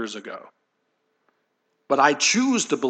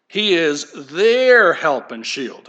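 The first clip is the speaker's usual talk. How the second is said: their pressed out slowly and louder; He is their help and shield, with their pressed out slowly and louder; stress on their; 2 semitones higher, 4 dB louder, slower than usual. Shown as a demonstration. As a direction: lean hard on their, slow and loud. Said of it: "I'm emphasizing the word there".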